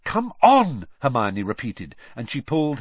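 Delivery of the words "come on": high, loud urging. A narrator's reading. Hermione pulls Harry and Ron away, urging. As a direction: hurry them away firmly.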